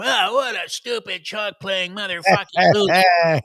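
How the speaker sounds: high, loud, slow mock heckle; acted jeering outburst. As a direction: heckle like an outraged critic, hammed up. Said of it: "how can people look at you and go".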